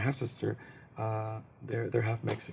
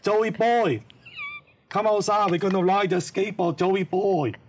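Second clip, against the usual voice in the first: put-on higher, louder playful Chinese accent calling out; Chinese friends' accent; 8 semitones higher, 7 dB louder than usual. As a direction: kids outside calling him to come skateboarding, Chinese accent. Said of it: "They'd be like".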